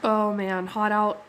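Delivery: normal talking voice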